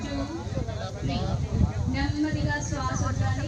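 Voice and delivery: hushed yoga breath guidance; soft spa whisper. Acted. Guides slow breathing softly.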